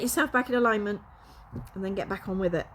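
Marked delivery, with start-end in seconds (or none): none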